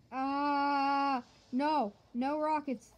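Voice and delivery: loud panicked cries; alarmed shouting; panicked yell. Real, not acted. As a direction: cry out as the rockets land. Hit by rockets.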